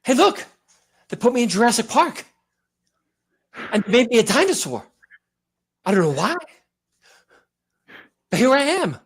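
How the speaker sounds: lightly voiced Wahlberg as a bewildered dinosaur; eager, baffled movie star, then a mock roar; Wahlberg impression. Doing Wahlberg cast as a dinosaur, mock roar at the end. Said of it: "Just be out of breath and talk with your hands".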